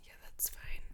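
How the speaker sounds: soft whisper. Real, not acted. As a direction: breathe it out, unvoiced. Whispered reply.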